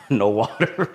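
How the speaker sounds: laughing while quoting the nurses; amused retelling of being refused water. Retold or imitated. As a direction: say it through a laugh.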